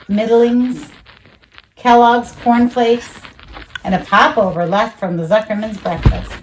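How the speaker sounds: ordinary delivery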